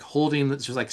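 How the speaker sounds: ordinary talk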